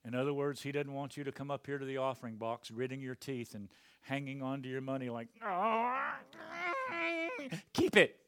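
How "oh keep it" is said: high, loud mock grudging voice; a stingy giver letting go unwillingly; pinched and reluctant. Stingy giver clutching money.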